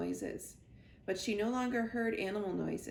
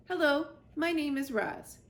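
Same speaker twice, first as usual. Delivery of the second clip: a touch higher robot greeting; 6 semitones higher, 4 dB louder than usual. A narrator's reading. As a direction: speak like a friendly robot. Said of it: "the robot spoke to the animals".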